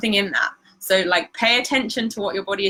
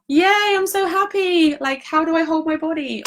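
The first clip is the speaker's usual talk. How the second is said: showing happiness; 9 semitones higher, 3 dB louder than usual. Shown as a demonstration. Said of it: "maybe the next step is".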